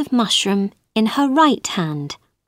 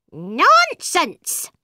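a higher bird voice dismissing her; scoffing bird; 8 semitones higher than usual. A narrator's reading. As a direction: brush it off, a little higher. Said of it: "said the bird".

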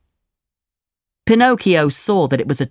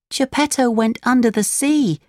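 put-on higher-pitched puppet boy voice; young puppet reporting bad news, pitched up; Pinocchio, distressed; 8 semitones higher than usual. A narrator's reading. Pinocchio tells the old woman of Geppetto, high puppet voice.

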